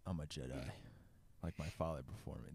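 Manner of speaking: mock solemn young Jedi; Luke impression. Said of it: "That's the worst impression I've ever heard".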